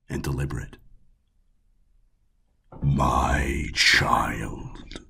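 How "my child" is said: purred. A narrator's reading. The god purring down at Tamara. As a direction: purr it like a cat toying with prey. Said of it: "he virtually purred".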